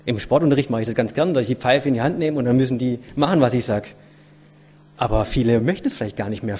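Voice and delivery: usual speaking voice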